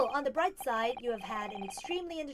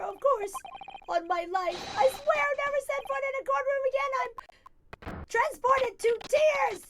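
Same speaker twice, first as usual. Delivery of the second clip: high, loud, gushing with relief; freed man gushing tearful thanks to the judge; overjoyed; 12 semitones higher, 6 dB louder than usual. Acted. Natsume overjoyed, freed from court.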